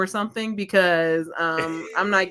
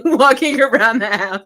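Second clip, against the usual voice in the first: louder, laughing through the words; laughing repeat; 5 semitones higher, 7 dB louder than usual. Retold or imitated. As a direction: repeat it cracking up.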